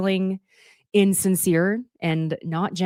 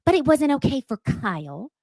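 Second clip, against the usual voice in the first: Dorit's mocking retort; 9 semitones higher, 5 dB louder than usual. To Sutton, mocking Dorit's jab in a high put-on voice. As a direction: mimic her pointed jab, pitched up. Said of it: "she says".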